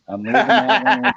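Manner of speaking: laughing through the words. Real, not acted. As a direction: chuckle while saying it. Laughing at the handle.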